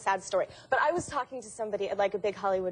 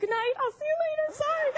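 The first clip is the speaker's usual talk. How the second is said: very high, squeaky mock farewell; high-pitched, laughing retold apology; embarrassed goodbye. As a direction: excuse yourself in comic mortification. Retells her embarrassed hasty exit.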